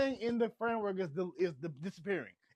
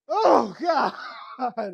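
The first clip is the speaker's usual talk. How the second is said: loud, high, sobbing cry; tearful exclamation; 3 semitones higher, 9 dB louder than usual. Real, not acted. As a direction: cry out in tears. Overwhelmed by the scene.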